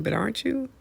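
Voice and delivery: "aren't you" swinging up high like a question; questioning rise. Real, not acted. Pressing the accused teacher for an admission, questioning rise on "aren't you". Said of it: "I'm saying that like a question".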